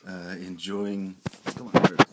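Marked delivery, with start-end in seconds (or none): 1.6-2.1 sudden loud call to a pet; calling the dog. Real, not acted. Owner urging his dog to say goodbye, loud calling out. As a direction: call out sharply to get the dog's attention.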